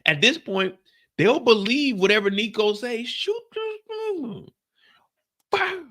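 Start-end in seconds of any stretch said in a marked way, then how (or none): 3.0-5.8 much higher, slow, strained plea at the screen; exasperated yell. Retold or imitated. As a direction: beg the show to do it. Yelling at the TV.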